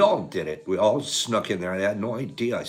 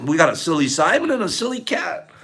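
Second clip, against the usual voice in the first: ordinary talk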